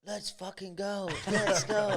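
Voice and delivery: high hyped-up shout of a mate; excited mate. Retold or imitated. Retelling his hyped mate's early-morning cry, high and excited. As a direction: fire up like a mate at dawn.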